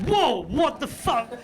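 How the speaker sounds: drawn-out high shocked whoa; slow, high startled exclamation. Retold or imitated. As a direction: recoil at the stench, slow and high. Shocked by the smell on entering.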